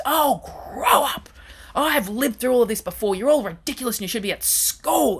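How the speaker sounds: hammed-up outraged elder telling off kids; retold scream. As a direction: imitate an angry old lady yelling. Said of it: "screamed at the top of her voice".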